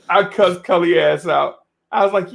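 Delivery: ordinary talk, nothing marked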